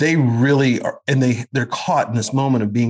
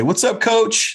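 much higher cheerful "What's up, Coach?" called out; players calling out; 12 semitones higher, slower than usual. Retold or imitated. Players greeting him from afar, mistaking him for Coach, called out high. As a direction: call across the field like a player greeting his coach. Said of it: "they would say".

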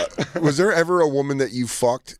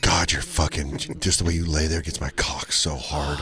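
mock seductive voice; 10 semitones lower, 6 dB quieter than usual. Mock sex talk, low voice.

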